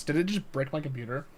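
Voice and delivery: ordinary talk, nothing marked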